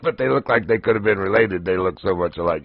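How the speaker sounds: usual speaking voice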